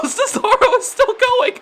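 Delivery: high, laughing through the words; amused you can't believe it; laughing disbelief. Real, not acted. Commentator laughing as the swing keeps spinning, laughing disbelief. Swing goes on absurdly long.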